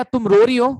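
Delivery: loud, anxious "are you crying?"; worried boyfriend. Retold or imitated. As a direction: call out to her in alarm. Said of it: "फिर बंदा बोलेगा".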